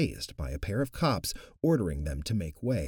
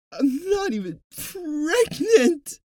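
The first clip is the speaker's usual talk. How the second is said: much higher, loud, slow crying shriek; 9 dB louder, slower than usual. Acted. Bloating woman answering the doctor, crying shriek. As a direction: shriek it through tears. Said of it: "she shrieked".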